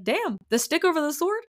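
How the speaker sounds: a light impression of a guard scoffing; half-laughing mock Chaol; imitating Chaol. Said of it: "And then Chaol is like".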